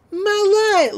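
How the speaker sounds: put-on high, loud little-boy cry; Gideon cries out. Recapping a cartoon scene, Gideon crying out for his light in a high yelp. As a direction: yelp it like a shrunken kid in a panic.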